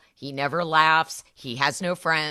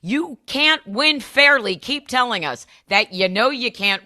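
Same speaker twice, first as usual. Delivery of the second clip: taunting; 6 semitones higher, 4 dB louder than usual. Real, not acted. Angry taunt at Trump.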